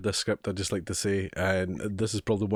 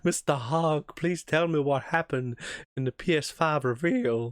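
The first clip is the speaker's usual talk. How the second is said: put-on awful southern accent; 4 semitones higher than usual. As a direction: swoon like a Tennessee Williams heroine, mock Southern. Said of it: "That's an awful southern accent".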